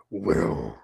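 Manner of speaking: very low, menacing monster voice; Vecna voice. Acted. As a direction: drop deep and growl out the name. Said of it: "Can you do a Vecna?".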